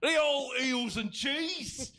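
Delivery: high, slow, hammed-up British cry; mock British accent. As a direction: call it out slowly in exaggerated British. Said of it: "clearly a product of the British Isles".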